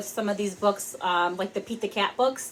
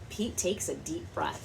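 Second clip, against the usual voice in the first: usual speaking voice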